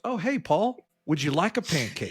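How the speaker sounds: mock-friendly pancake offer; goofy grinning employee. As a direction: imitate a big goofy grin in the voice. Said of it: "has this big, goofy grin on".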